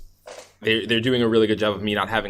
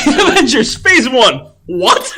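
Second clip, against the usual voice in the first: laughing; 13 semitones higher, 6 dB louder, much slower than usual. Real, not acted. Joking about Avengers titles.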